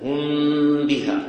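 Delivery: "هم بها" with the meem of "هم" held as a long hum moving into the ba; إخفاء الميم. Shown as a demonstration. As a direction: chant slowly, gliding from the meem of "هم" to "بها". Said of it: "من الميم أذهب إلى الباء". ("هم بها" بإخفاء الميم عند الباء مع غنة)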